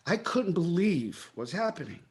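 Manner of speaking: ordinary talk, nothing marked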